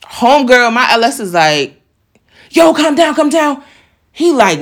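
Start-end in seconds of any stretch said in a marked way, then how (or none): 2.5-3.6 higher-pitched, urging him to settle; imitated urgent plea. Homegirl to the man bolting up the steps, imitated urgent plea. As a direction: imitate a friend trying to calm someone.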